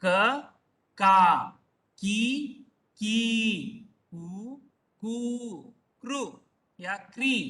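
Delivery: each syllable alone, pauses between, rising and falling tunes; syllable drill recitation. Shown as a demonstration. Teacher reading the barakhadi together with students, measured recitation. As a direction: recite the letter chart slowly, stretching long vowels. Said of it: "एक साथ हम पढ़ेंगे अभी".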